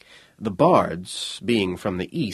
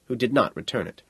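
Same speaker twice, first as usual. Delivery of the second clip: plain reading voice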